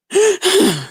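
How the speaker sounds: high, exaggerated wheeze for air; mock breathless gasp. Showing her how she talks, mock winded gasping. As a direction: gasp like someone badly winded, mockingly. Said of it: "That's how you talk".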